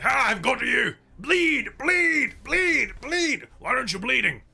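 loud, high frenzied monster yells; attacking red cap. Acted. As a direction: shriek it while lashing out at the enemy. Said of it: "it's going to lash out".

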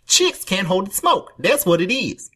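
high, put-on stoned movie voice; Anthony Michael Hall. Stoned teen declaring to his friends, high put-on voice. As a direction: squeeze out a high-pitched voice as if holding smoke. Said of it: "he does that voice".

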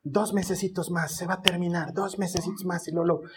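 low, soft self-insistent muttering; muted stubbornness. Acted. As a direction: insist under your breath. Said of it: "y yo sigo en mi terquedad".

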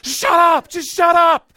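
sudden high, loud exasperated yell; yelling at the kids. Retold or imitated. A dad losing patience with his kids, yelling. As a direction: blow up at the noisy kids, full volume. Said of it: "I just tell the kids".

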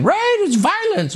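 very high, drawn-out mocking singsong; sing-songy woman. Host mocking a woman on cable news, sing-songy. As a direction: mimic a pundit in a slow, high-pitched lilt. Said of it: "talking in a sing-songy voice".

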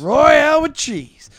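slow, high, loud savoring; slow loud amazed echo; amazed repeat. Acted. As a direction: relish the strange name.

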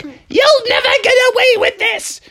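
very high, furious screech; thwarted goblin villainess. Acted. Anne shakes her fist at frogs, high villainous screech. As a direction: rage after your escaping prey.

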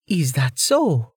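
teasing, drawn-out interest; playful. A narrator's reading. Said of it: "Amelia asked playfully".

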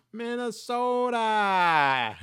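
put-on slow, high, loud Minnesota accent; hammed-up Minnesota accent yell. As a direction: stretch it out in a broad Minnesota accent. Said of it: "You can't say it without the accent".

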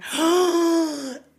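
loud high gasp of relief; sharp gasp. Real, not acted. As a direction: gasp as breath comes back. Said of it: "getting that second wind".

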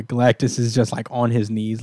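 usual speaking voice

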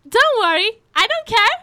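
high, cheery punchline replay; smiling reassurance. Retold or imitated. Waitress repeating her answer to the customer, cheerfully oblivious. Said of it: "with a smile on my face".

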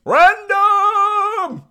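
very high, loud drawn-out show-opening cry; Rob. Retold or imitated. Imitating a podcaster buddy's intro, high loud holler. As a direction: belt out the word as a long high holler. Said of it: "letting me imitate your voice".

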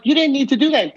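high, stung reproach; hurt protest. Retold or imitated. Telling his uncle's ghost off after the slicer falls, high and stung. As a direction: complain like you were wronged.

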